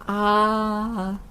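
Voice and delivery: a lightly voiced low "ah" for the doctor; mild little-pig ah at the doctor's; open-wide ah. George opens mouth for doctor.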